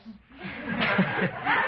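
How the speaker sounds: high burst of laughter; laughing. Real, not acted. Speaker tickled by the father calling pipes home, laughing. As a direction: crack up at your own story.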